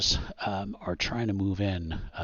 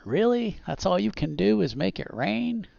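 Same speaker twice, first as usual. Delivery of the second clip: high-pitched, louder mocking disbelief; scornful villain; 12 semitones higher, 4 dB louder than usual. Game master voicing villain taunting player about rain, mocking disbelief. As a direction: scoff in a high, incredulous lilt.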